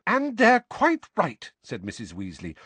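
high stern motherly voice; stern Mrs Weasley. A narrator's reading. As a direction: lay down the law like a mother.

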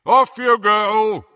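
big drawn-out dismissal; booming. Acted. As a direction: boom it to the crowd. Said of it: "he boomed to the Muggleborns".

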